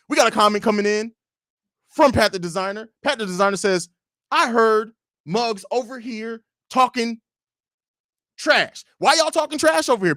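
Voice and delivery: Pat the Designer. Acted. Showing how a fellow host reads comments, high halting impression. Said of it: "This is how he would read that comment".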